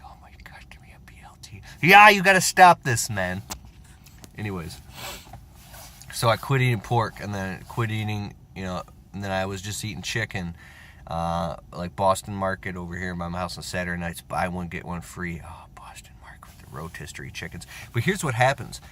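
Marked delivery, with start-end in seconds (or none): 0.0-1.6 hushed, longing whisper; craving whisper. Acted. Craving a BLT. 1.8-3.4 mock self-scolding. Scolding himself after craving. 15.4-17.6 dreamy whisper. Acted. Longing for chicken.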